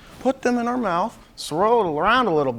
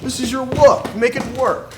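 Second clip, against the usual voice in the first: lightly voiced fashion mentor, higher and louder; Tim Gunn; 8 semitones higher, 6 dB louder than usual. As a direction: coach a designer with a hint of Tim Gunn. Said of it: "I could be Hofstra's very own Tim Gunn".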